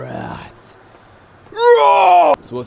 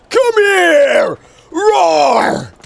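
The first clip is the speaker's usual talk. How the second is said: loud furious furry beast roar; hammed-up furry rage at the human; furry villain rant; 13 semitones higher, 9 dB louder, much slower than usual. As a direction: bellow like a furious beast-man. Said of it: "Nate Higgers".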